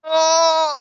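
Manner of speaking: high loud crying groan; pained cry. Real, not acted. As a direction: cry out in release. Patient expulse en criant. (cri de douleur aigu)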